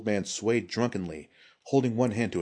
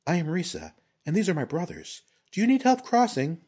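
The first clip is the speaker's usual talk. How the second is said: high-pitched woman character, offering help; friendly ronin leader; 10 semitones higher, 5 dB louder than usual. Acted. Ronin leader offers stranded man help, high and friendly. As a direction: introduce yourself brightly in a raised voice.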